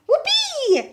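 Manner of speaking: high, loud gleeful whoop; shouted whoopee. A narrator's reading. Grandma shouting with glee as she grows. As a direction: whoop it out as she shoots upward. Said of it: "she shouted".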